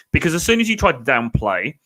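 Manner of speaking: ordinary talk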